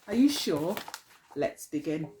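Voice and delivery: ordinary delivery, nothing marked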